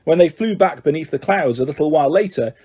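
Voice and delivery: ordinary delivery, nothing marked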